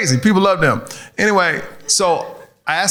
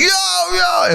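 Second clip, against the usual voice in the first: calling out Yo; 14 semitones higher than usual. Retold or imitated. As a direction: yell Yo across the street like a kid. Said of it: "everybody like".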